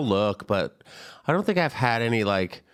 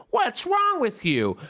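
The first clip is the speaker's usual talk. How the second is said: outraged commenter; 9 semitones higher, slower than usual. Retold or imitated. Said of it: "they want people to be like".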